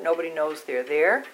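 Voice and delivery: ordinary talk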